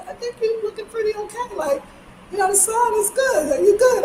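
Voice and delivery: high, lightly mocking imitation of a man reassuring her; Trent. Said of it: "And Trent's like".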